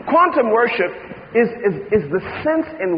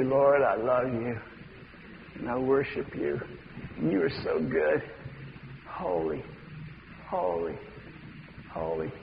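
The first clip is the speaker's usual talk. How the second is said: much softer and lower, breathed worship; intimate whispered prayer; 8 semitones lower, 13 dB quieter than usual. Acted. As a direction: pray it tenderly, barely voiced.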